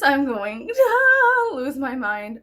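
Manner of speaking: slow, loud wail stretched out; hammed-up despairing cry; mock breakdown. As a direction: wail it as if cracking up. Exaggerated breakdown over accents.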